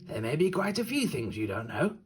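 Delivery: high, friendly wolf host voice. Acted. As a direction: welcome the child like a kindly wolf host. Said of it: "said the last wolf".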